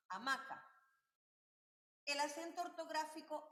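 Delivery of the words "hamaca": "hamaca" said louder, stressed on the middle "ma". Shown as a demonstration. Teacher showing where the accent falls, "hamaca" with stressed "ma". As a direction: hit the "ma" in "hamaca". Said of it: "recae en la sílaba ma".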